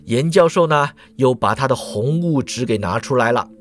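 plain reading voice

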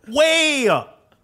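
high, drawn-out cry of exaggeration; comic high-pitched emphasis; exasperated exclamation. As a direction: pitch it way up to show how loud. Music cranked up in the story.

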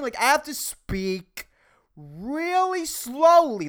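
ordinary talk

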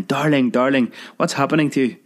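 high, panicked pleading; a frightened fiancée begging for help; high panicked cries over a choking lover; frantic crying. Acted.

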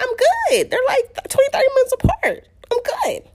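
high-pitched defensive retort; acted-out squeal of reassurance; insistent protest. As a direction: argue back, voice way up. Arguing back at upset husband.

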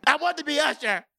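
giggling through the words; high, breaking into laughter; laughing. Real, not acted. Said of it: "Sorry, guys, I'm sorry".

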